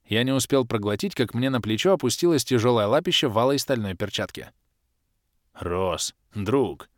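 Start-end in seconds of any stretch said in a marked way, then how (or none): 5.5-6.9 heartfelt bass. A narrator's reading. Bars sweet-talking Ros for a sip. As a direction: coax his friend gently. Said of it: "проникновенно пробасил Барс".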